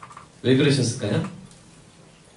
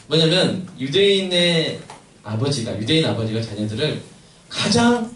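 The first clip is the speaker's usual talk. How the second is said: ordinary talk, nothing marked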